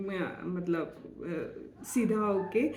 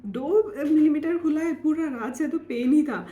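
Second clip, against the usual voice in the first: higher, louder complaint; retold protest; 7 semitones higher, 5 dB louder than usual. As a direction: retell your own protest to the doctor. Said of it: "मैंने बोला". (शिकायत भरा विरोध)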